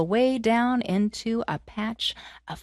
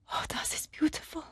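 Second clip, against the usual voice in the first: softer whispered aside, mock German; awed whisper; 5 semitones higher, 10 dB quieter than usual. Prince hearing her song in the woods, whispered. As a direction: breathe it in wonder.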